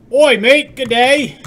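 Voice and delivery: loud, high, hammed-up bad Australian; shitty Australian accent. Streamer attempting British for chat, landing in bad Australian accent. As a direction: shout it in a badly faked Aussie accent. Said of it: "that's kind of like a shitty Australian".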